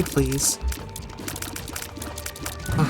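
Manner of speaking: ordinary talk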